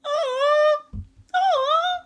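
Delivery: very high, rising squeals of amazement; overwhelmed little high-pitched gasps of awe; awed squeal. Retold or imitated. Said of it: "I was like".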